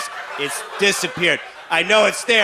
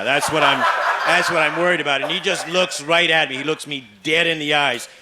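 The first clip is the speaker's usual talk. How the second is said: normal talking voice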